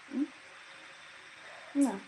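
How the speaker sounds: normal talking voice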